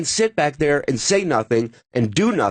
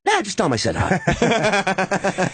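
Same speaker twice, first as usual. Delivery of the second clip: slow, higher-pitched offhand reversal; imitating Dice; 5 semitones higher, slower than usual. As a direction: wave it off after the rant. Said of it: "And he goes".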